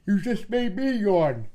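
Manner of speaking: high, loud, drawn-out cooing. Real, not acted. Owner to the yawning dog, high drawn-out baby talk. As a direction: coo it slowly and loudly, way up high.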